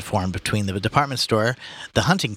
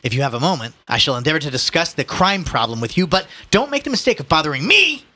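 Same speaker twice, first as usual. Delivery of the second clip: higher, indignant speechifying, building to a loud finish; pompous Ignatius; 7 semitones higher, 4 dB louder than usual. Acted. Ignatius grandly warning the policeman not to bother him, pompous. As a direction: lecture an officer with grand indignation.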